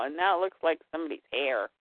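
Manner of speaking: ordinary talk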